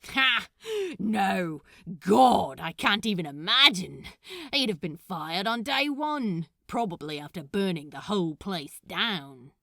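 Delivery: loud, laughing disbelief; barking laugh. Acted. Crowley laughs at the idea of the fiancé at work. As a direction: bark it out through a laugh. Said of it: "Crowley barked out a laugh".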